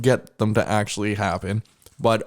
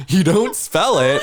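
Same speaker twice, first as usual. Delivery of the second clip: laughing; 7 semitones higher, 5 dB louder than usual. Real, not acted.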